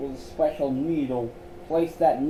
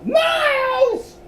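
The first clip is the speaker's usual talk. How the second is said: high, loud call of his name; sharp high exclamation; exclaimed; 7 dB louder than usual. Acted. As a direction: shout to stop him short. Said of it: "Luan exclaimed".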